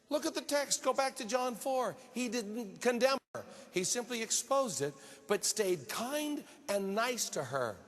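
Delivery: high, driving sermon voice; emphatic preaching. Real, not acted. Preacher sends congregation back to the text, emphatically. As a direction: preach it hard, pitched well up.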